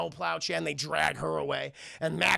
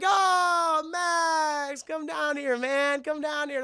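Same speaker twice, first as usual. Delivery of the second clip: high, drawn-out welcome; acted relatives cheerily calling someone over; family greeting; 10 semitones higher, much slower than usual. Family warmly calls Max over.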